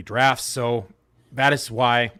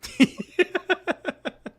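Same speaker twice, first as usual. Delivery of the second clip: high-pitched giggling laugh; real laughter. Real, not acted. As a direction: crack up at the joke, high and breathless. Both hosts joking about moving on.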